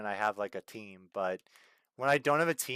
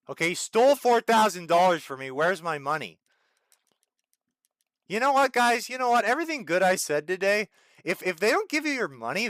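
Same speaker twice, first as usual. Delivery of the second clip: raised, louder, outraged at being cheated; indignant rant; 6 semitones higher, 6 dB louder than usual. Real, not acted. Streamer venting to viewers about stolen pay, outraged. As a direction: vent like you've been robbed.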